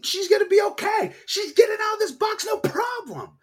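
high shouted mock confidence; hopeful cheer. Urging the bride out of the coffin, a high hopeful shout. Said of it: "you're like".

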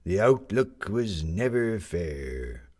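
slow, a hint of an old sailor; unhurried old seaman buying a paper; elderly man in a pea coat buying a paper, slow. A narrator's reading. Narrator voices old sailor.

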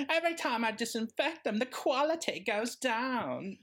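mock Moira; Moira impression. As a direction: mourn her wigs grandly. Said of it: "can you do a good Moira".

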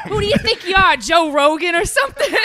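loud laughing tease; laughing mock jab. Real, not acted. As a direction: tease them through laughter. Mocking friend loudly, laughing.

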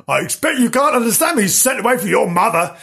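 high, fast, babbling voice; loud gushing. Acted. Idiot sister babbling at the puppy, high and fast. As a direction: rattle it out high and quick.

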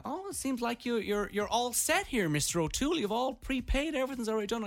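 high, sing-out customer-service voice; chirpy clerk. Retold or imitated. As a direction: imitate an over-helpful desk clerk. Voicing car rental clerk greeting him.

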